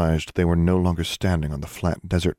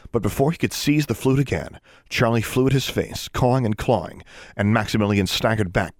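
ordinary delivery

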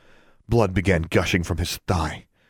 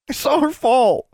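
high, loud, upset cry; distraught boy; 5 dB louder than usual. A narrator's reading. Crying boy tells heroine of the fall, distraught. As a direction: cry it out, pitched way up.

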